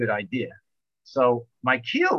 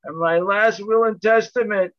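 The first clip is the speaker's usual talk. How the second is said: dad's grim reply; 8 semitones higher, 3 dB louder than usual. Retold or imitated. Dad answers from the cabin.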